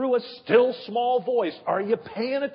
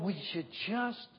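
pleading God voice; 3 dB louder, slower than usual. Pretend. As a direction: coax gently, then build to a loud appeal. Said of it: "is watching us going".

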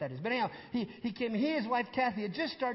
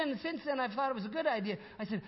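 ordinary talk, nothing marked

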